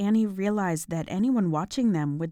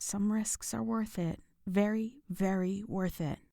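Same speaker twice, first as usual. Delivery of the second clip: sly hushed advice; 2 semitones higher, 7 dB quieter than usual. Acted. Melina's sly advice, whispered low.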